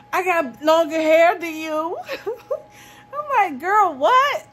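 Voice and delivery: high, loud laughing retelling; real laughter over the story; laughing mockery. Real, not acted. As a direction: giggle through the quote. Laughing, mocking her claim.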